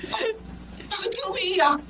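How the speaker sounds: anxious, high and hushed; alarmed parent on the phone; a mother frightened by her child's tears; worried mother. Acted. Mother hears daughter crying.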